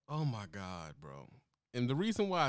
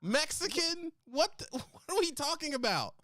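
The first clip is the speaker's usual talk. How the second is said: surprised; 11 semitones higher than usual. Real, not acted. Host is stunned by the remark.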